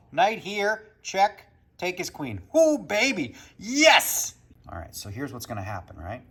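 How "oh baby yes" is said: excited triumph. Real, not acted. Taking opponent's queen.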